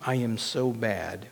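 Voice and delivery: low, soft self-reproach; broken inner voice. Acted. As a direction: confess quietly to God, with long pauses. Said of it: "my inner broken voice tells me".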